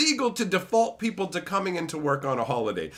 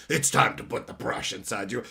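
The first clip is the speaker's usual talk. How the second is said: mock Urza; 5 semitones lower than usual. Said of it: "And then he'd say".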